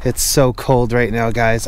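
usual speaking voice

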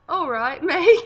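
loud, high Australian accent; put-on Australian accent. Shown as a demonstration. Reluctant answer to a request for her best accent, Australian accent. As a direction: exclaim it in a hammed-up Aussie accent. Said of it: "In your best accent".

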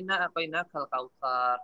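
slow recited "Inna a'tainakal kautsar" with the lam clearly sounded; Quran recitation. Shown as a demonstration.

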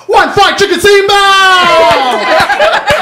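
high, loud, very slow shout; chorus shout. Retold or imitated. Five cooks answering the cashier, loud slow chorus shout. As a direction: bellow it back like a kitchen crew. Kitchen staff shout back together.

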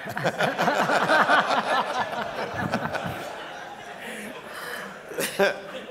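big high hearty laugh, loud; long laugh. Real, not acted. Laughing at his own Shaquille O'Neal joke, long hearty laugh. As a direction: let a long delighted laugh roll out.

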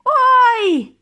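sudden high loud yelp; shriek. A narrator's reading. Boy jumps as if jabbed, shrieking. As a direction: yelp as if stabbed with a pin. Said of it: "заверещав".

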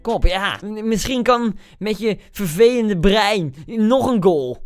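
ordinary talk, nothing marked

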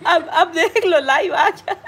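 high, loud words through laughter; real laughing. Real, not acted. Streamer amused by child gatecrashing the live, laughing. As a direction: talk while cracking up.

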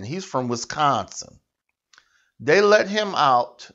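ordinary talk, nothing marked

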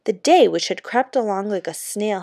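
ordinary delivery

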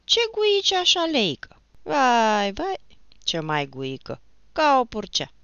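high, loud baffled complaint; bewildered. A narrator's reading. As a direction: react baffled to all that squealing. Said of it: "a mai adăugat mocanul nedumerit". (nedumerit, cu glas ridicat)

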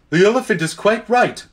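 high, prim animal voice; tapir voice. A narrator's reading. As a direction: agreeable tapir. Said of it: "said the tapir".

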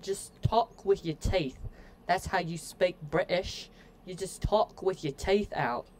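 exaggerated British accent, teeth forward; toothy British accent. Shown as a demonstration. Streamer giving viewers an accent tip, toothy mock British accent. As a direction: demonstrate British by talking with your teeth out. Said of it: "an accent tip".

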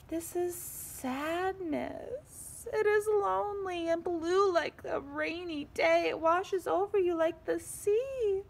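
a touch of crying for the sad page; mock crying. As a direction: read it with a hammed-up sob in the voice. Reading the sadness page aloud.

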